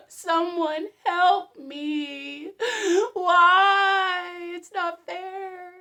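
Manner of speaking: high, loud, slow self-pitying whimper; mock crying victim; mock crying whine. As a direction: ham up a wounded victim's sob. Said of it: "is laying on the ground crying".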